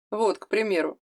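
ordinary delivery, nothing marked

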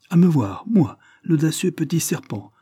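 scornful outburst. A narrator's reading. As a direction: spit out the insult indignantly. Maid scornful at summons.

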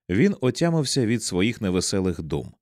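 ordinary delivery

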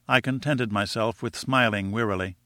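plain reading voice

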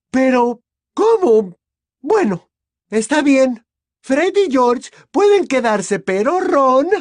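high, loud, flustered anger; irate. A narrator's reading. Mother grudgingly letting the twins stay, irate. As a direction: give ground angrily, high and loud. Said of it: "estaba colorada de ira".

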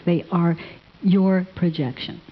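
ordinary delivery

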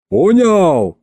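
high, triumphant holler; joyful yell. A narrator's reading. Peasant gloats to his opponent, yelling joyfully. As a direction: crow it at your opponent. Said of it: "радостно заорал мужик".

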